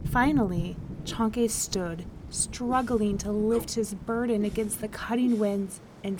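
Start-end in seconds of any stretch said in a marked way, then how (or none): none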